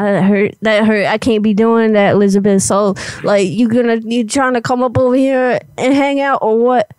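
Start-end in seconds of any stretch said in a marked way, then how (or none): none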